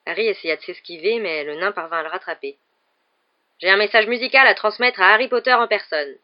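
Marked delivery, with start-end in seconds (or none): 3.6-6.2 loud, high, forceful announcement; booming, insistent messenger; menacing dwarf. A narrator's reading. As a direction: deliver it as a threatening dwarf. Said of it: "d'un air menaçant".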